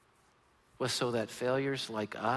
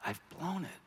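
despairing whisper; 8 dB quieter than usual. Retold or imitated. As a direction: breathe it out like you just ruined everything. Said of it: "and you go".

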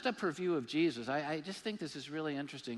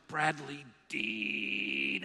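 angry mom calling; 4 dB louder, much slower than usual. Retold or imitated. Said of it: "you knew she was just angry".

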